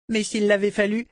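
put-on high, eager little girl's voice; a delighted small child gushing; child voice full of admiration; little girl Rachel.

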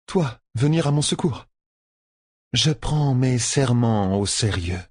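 a Seanchan woman keeping her word; Leilwin's voice. Acted. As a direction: state your oath slowly and gravely. Leilwin answers Egwene.

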